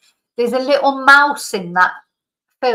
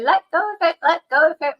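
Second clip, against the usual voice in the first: retold scream; 8 semitones higher, faster than usual. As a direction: re-enact screaming at the dog, pitched up without full volume. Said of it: "So I'm screaming at him".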